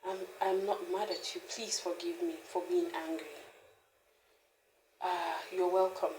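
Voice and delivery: quiet, loving reassurance; tender apology to unborn baby. Retold or imitated. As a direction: speak softly to the baby you carry as if in your hands. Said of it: "lay my hands on my five weeks or six weeks pregnancy and say".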